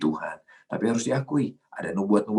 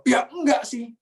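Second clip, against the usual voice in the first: high-pitched mock backpedalling; defensive denial; 4 dB louder than usual. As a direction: deny it in a much higher voice. Acted evasive denial when challenged. (mengelak dengan nada tinggi)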